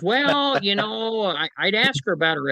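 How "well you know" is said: high, lightly voiced old lady; elderly woman quoted. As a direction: pipe up like the elderly client, a touch higher. Retelling the elderly client's words.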